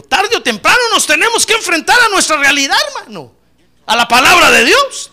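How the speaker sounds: loud, high, insistent preaching; forceful preaching. Real, not acted. Preacher warning that reality must be faced, forceful preaching. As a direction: drive it home to the congregation.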